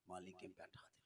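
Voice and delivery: hushed, secretive whisper. Acted. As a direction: lean in and whisper it. Secret request to the maulana.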